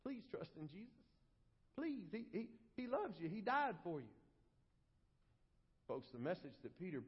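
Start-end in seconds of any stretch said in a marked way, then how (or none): 0.0-4.1 soft, faltering, pleading preacher; small, imploring voice, imitating a timid appeal; mock begging. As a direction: beg them meekly, very softly. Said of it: "we beg people".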